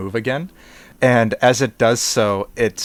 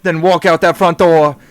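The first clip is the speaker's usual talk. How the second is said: mock voice; 4 semitones higher, 8 dB louder than usual. GM echoes in a put-on voice.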